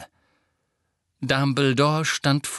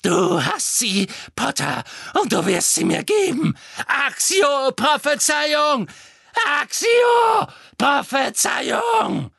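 high, furious shrieking that grows more desperate; horror behind fury; 13 semitones higher, 5 dB louder than usual. A narrator's reading. Witch demands the prophecy from Potter, panicked fury. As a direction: build from angry demand to drawn-out, panicked screamed spell. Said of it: "das Entsetzen hinter ihrem Zorn hören".

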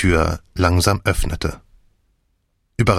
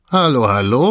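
higher, louder hearty call; 5 semitones higher, 4 dB louder than usual. A narrator's reading. Jeb greeting an old acquaintance, calling out cheerfully. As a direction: call it across the room, delighted. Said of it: "rief er".

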